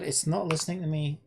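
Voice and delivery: ordinary talk, nothing marked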